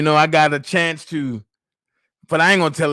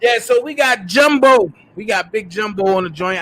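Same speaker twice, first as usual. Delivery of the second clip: ordinary talk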